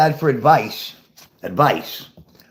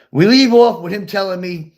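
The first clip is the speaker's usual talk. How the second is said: usual speaking voice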